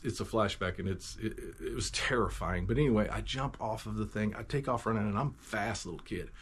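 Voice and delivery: normal talking voice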